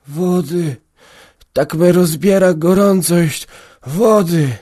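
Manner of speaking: lightly voiced feverish old man begging; weak, pleading sick farmhand. A narrator's reading. Sick old man begs water. (chory stary parobek prosi o wodę)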